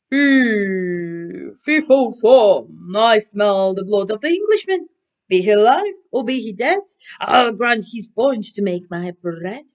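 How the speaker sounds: loud, booming giant chant; big storybook giant bellowing his rhyme; giant's roar. Acted. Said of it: "cried the giant".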